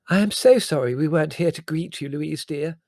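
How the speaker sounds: high, loud effusive apology; gushing cousin Prue. A narrator's reading. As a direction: apologise profusely as the fussy cousin.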